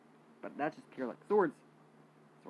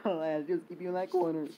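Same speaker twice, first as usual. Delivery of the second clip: laughing; 4 semitones higher, 7 dB louder than usual. Real, not acted. Laughing brag after win.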